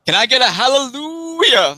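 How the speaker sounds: high, loud revival-preacher call; mock preacher. Joking about growing up in the South, mock preacher call. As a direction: whip up the congregation.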